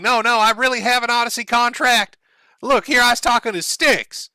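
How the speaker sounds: high, loud mock Ralph protest. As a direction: ham up a defensive Ralph. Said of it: "So Ralph starts posting D Ms saying".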